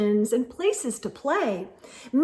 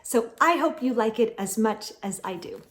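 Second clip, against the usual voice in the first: ordinary delivery, nothing marked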